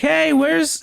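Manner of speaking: high-pitched, loose mock-drunk call; drunk Supergirl. Supergirl turning up drunk at Superman's fortress, mock drunk. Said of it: "she's kinda drunk and shit".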